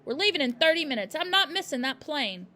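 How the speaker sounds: loud fast angry orders; shouting father. A narrator's reading. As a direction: rush her, angrily.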